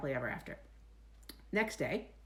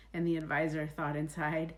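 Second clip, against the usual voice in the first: usual speaking voice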